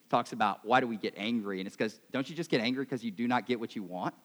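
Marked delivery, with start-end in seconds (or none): none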